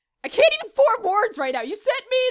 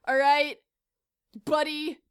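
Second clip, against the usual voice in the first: usual speaking voice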